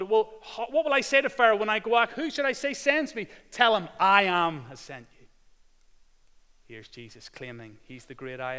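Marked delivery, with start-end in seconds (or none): 0.0-5.3 loud, high full-force preaching. Acted. Preacher retelling Jesus to the congregation, loud and high. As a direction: proclaim it to the whole church.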